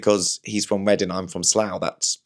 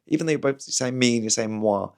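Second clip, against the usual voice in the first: ordinary talk, nothing marked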